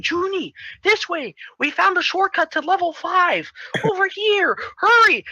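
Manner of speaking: high-pitched urgent calling voice; Carmen. Retold or imitated. Telepathic call to Junie about a shortcut, high urgent voice. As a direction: call out high, hurrying him along. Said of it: "we hear Carmen".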